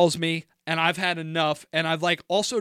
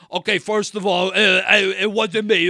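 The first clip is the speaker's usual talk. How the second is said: imitating an old-school Italian Jersey dude, a bit louder; 3 semitones higher, 6 dB louder than usual. Imitating the roommate on the phone, old-school Jersey Italian accent. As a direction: lightly mimic a Jersey Italian guy protesting. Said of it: "like, old school Italian, like, Jersey dude".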